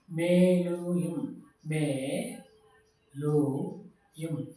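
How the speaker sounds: मेलुयम stretched out very slowly, syllable by syllable; teacher drawing out मेलुयम so students can copy it; drawn-out Tamil word. Shown as a demonstration. Teacher spelling a new Tamil word slowly for the class. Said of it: "मैंने board पे लिखा है".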